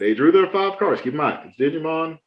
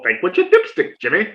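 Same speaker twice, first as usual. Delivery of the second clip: lightly voiced, smug calm catchphrase; teasing repeat; 3 semitones higher than usual. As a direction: needle her by repeating it coolly. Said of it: "Stop saying that".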